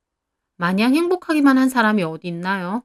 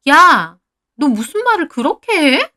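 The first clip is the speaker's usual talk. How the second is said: indignant outburst; 7 semitones higher, 6 dB louder than usual. Acted. Friend protests in a quarrel.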